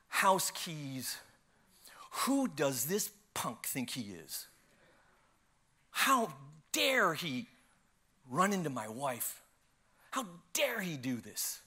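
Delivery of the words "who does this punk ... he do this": slow, low-simmering retold outrage; fuming anger. Husband at the police station stewing over the thief, fuming quietly. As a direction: draw out each indignant word, held in. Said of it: "I'm just fuming in anger".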